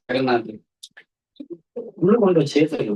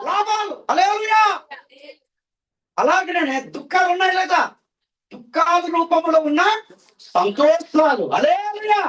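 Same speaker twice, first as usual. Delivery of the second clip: preaching at full force; 12 semitones higher than usual. Acted. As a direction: call it out to the congregation. Preaching call and response. (ఉత్సాహంగా బోధించడం)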